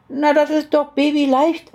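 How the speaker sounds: put-on high, childlike matter-of-course voice; little girl. A narrator's reading. Little girl answers her aunt, high child voice. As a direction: answer as a small child stating the obvious.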